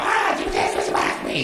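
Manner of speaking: very high, whispery critter squeal; creature gibberish. Retold or imitated. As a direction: squeak out nonsense like a little rat-monkey. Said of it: "He just give me gibberish".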